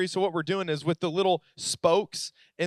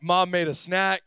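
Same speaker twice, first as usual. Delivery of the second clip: ordinary talk, nothing marked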